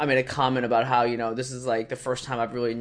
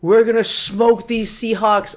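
high, loud, slow trash talk; hyped boast; 9 semitones higher, 7 dB louder, slower than usual. Retold or imitated. Bronco fan boasts to the front desk guy, loud and hyped. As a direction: hype yourself up before the game.

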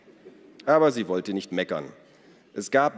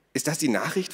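raised pitch, eager asking; excited question; 7 semitones higher than usual. A narrator's reading. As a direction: ask it eagerly, pitched up high. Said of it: "fragte Ada aufgeregt". (aufgeregt gefragt)

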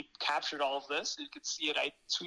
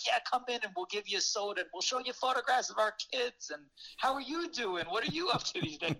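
put-on welcoming old couple; 8 semitones higher than usual. Imitating welcoming elderly couple.

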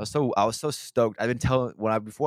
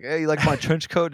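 mock proud blind guy; 8 semitones higher, 5 dB louder than usual. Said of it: "Yeah, that'd be pretty funny".

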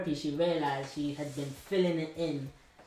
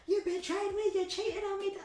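much higher mock shout of betrayal; imitating her angry yell. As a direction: throw her accusation back as a hammed-up yell. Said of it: "cussing me off".

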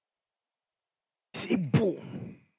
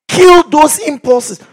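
loud, slow, high command to kill the impulses; commanding shout; 10 semitones higher, 11 dB louder, slower than usual. Retold or imitated. Preacher ordering the flock, loud command. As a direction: bark it out like an order. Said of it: "So he says".

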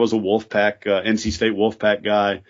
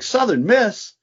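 high, amazed question; retold amazement at the tent, pitched up high; imitating surprised golfer; 12 semitones higher, slower than usual. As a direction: mimic a golfer spotting the tent, much higher. Golfers spotting the Southern Miss tent.

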